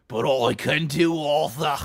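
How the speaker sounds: loud put-on Brummie; hammed-up Brummie impression; attempted Brummie accent. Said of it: "the Brumman accent needs work".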